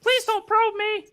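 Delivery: a hammed-up hurt falsetto; pained squeaky voice. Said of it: "Sounds like you got hit in the balls".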